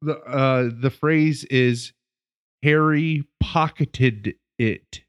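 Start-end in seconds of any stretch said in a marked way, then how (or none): 2.6-5.0 HARRY POCKETED IT spaced out slowly, word by word; "Harry pocketed it", drawn out to get it out; super slow. Retold or imitated. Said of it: "super slow to get it out".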